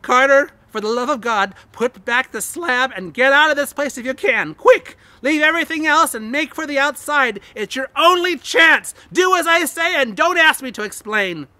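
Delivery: loud, high urgent shouting; wild consternation. A narrator's reading. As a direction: scream the warning, desperate. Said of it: "in a pitch of wilder consternation".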